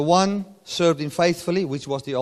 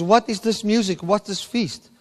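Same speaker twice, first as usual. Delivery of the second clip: indignant surprise; 7 semitones higher, 5 dB louder than usual. Acted. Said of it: "It says".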